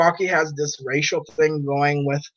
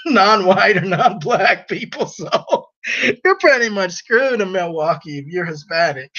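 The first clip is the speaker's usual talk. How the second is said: words breaking up with laughter; laughing while talking; 6 semitones higher than usual. Real, not acted. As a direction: try to finish the sentence through giggles. Cracking up at own remark.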